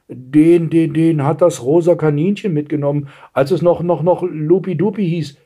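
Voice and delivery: put-on nervous girl tripping over words; stammering. A narrator's reading. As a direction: stutter out the awkward confession. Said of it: "stotterte das Mädchen". (verlegen stotterndes Mädchen)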